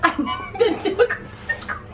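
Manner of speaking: high, laughing and teary; laughing through tears. Real, not acted. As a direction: giggle while welling up. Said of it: "I had a happy cry".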